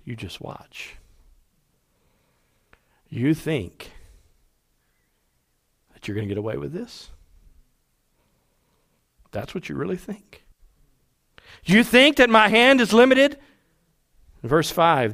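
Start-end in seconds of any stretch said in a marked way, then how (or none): 0.0-10.4 hushed, near-whispered warning; low, very soft menace with long pauses; scoffing. Acted. God answering rebels who defy him, quiet scoffing. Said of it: "he moves from laughter to scoffing". 11.6-13.4 raised, higher-pitched indignant question; scoffing challenge. Acted. God confronting those who think him limited, scoffing challenge. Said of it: "to scoffing".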